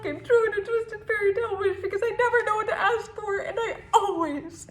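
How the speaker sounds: half laugh, half sob; crying laugh. Acted. Bitter wish come true.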